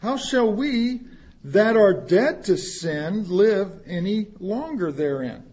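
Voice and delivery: normal talking voice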